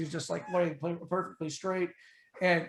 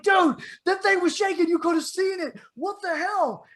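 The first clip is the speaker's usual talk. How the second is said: much higher, excited rattled protest; agitated; 12 semitones higher, 4 dB louder than usual. Retold or imitated. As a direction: still freaked out, berating him. Shaken, berating friend.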